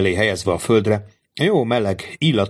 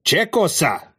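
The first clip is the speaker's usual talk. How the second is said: kérdő haramia; 9 semitones higher, slower than usual. Acted. Bandit asks in foreign tongue.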